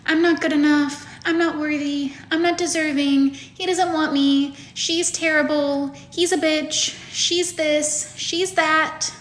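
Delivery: high, whiny self-pitying inner voice. Acted. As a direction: whine a singsong list of complaints. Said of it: "this is my little whiny voice".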